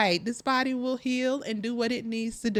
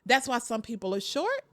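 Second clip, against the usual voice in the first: normal talking voice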